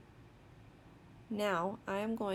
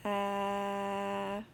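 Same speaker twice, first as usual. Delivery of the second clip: steady, monotone "uhhh" held long; sustained "uh" sound. Shown as a demonstration. Teacher models the sounds on the page, sustained "uh". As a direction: hold the vowel on one even note. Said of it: "tell me all of the sounds".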